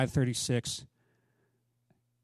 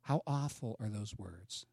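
ordinary talk

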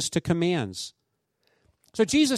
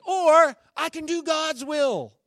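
dramatic declaration; 6 semitones higher, 6 dB louder, slower than usual. Retold or imitated. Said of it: "He goes".

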